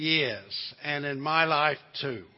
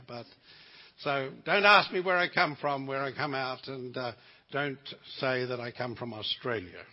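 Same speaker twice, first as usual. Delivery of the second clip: ordinary delivery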